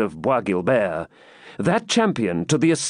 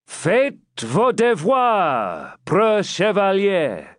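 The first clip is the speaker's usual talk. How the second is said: higher, very slow proclamation; herald's cry; 8 semitones higher, slower than usual. Acted. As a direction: call it out across the lists. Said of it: "uplifted his voice".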